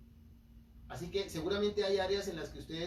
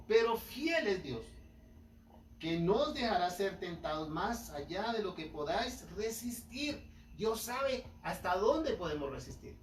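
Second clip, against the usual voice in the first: higher, louder, slow and emphatic; preaching; 5 semitones higher, 4 dB louder, slower than usual. Acted. A preacher proclaiming scripture to the congregation, slow and emphatic. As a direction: proclaim God's faithfulness to the church.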